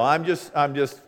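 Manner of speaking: usual speaking voice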